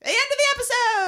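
high, fast cheery announce; excited announcer. Acted. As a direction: call out the ending. Announcing the end.

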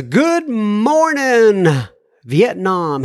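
slow, hammed-up radio shout-out; Good Morning Vietnam. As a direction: stretch it like the old movie's DJ. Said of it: "He didn't say, "Good morning, Vietnam".

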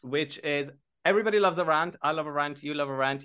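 ordinary delivery

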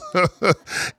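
high burst of laughter; gleeful high laugh. Real, not acted. Player laughing after taunting his uncle, gleeful laugh. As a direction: laugh at your own jab.

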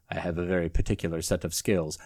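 put-on low, quiet, menacing voice with a failed Australian accent; Ken Ham. Looming over a bed at night, low menacing attempt at Australian. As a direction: drop deep and soft for a badly faked Australian threat. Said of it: "stating in his Australian accent".

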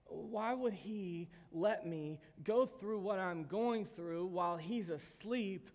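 slow, heavy pleading questions; anguished questioning. Acted. Believer in a storm questioning God, anguished. Voicing a disciple caught in the storm.

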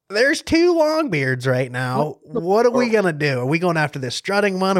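retold giddy panic over a choice; excited dilemma. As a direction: blurt it out, thrilled and torn. Retelling frantic choice between two birds.

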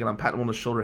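usual speaking voice

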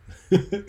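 brief high-pitched laugh; short chuckle. Real, not acted. Preacher laughs at his own banner story, briefly amused. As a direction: let out a quick little laugh.

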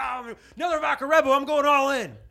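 very high, loud party yell; drunk gambler shout. Pretend. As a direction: whoop like a wasted gambler.

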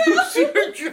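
high, laughing through the question; laughing hard. Real, not acted. Dad laughs high about Abby jumping at the chair. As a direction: cracks up over her jump.

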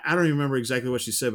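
ordinary talk

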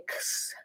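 put-on x whispered, toneless, like a child's failed cheese; whispered mispronunciation. Shown as a demonstration. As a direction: breathe x with no voice, as the child's wrong sound. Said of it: "y dice".

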